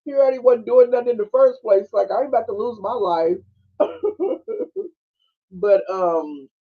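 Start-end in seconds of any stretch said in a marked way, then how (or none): 2.1-3.4 a hint of a scared guard; lightly voiced nervous guard; imitating the guard laughing; mock guard voice. Voicing fleeing guard. 3.8-4.9 very high giggle; high laugh. Real, not acted.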